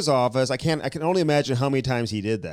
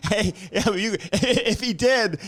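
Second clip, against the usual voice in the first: laughing; 8 semitones higher, slower than usual. Real, not acted. Laughing at the [name]-[name] joke.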